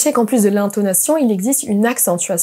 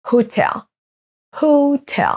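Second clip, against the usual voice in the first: "Hotel" as ho-TEL; second-syllable stress; 5 semitones higher, slower than usual. Shown as a demonstration. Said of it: "accentués sur la deuxième syllabe".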